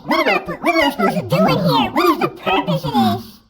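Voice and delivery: chipmunk voice. Real, not acted. High filter voice laughing.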